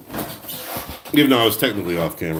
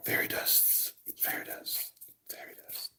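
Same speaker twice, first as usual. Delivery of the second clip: fading whisper; 16 dB quieter than usual. Pretend. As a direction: whisper fairy dust, fading away. Sprinkling glitter, fading whisper.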